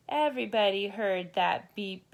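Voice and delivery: ordinary delivery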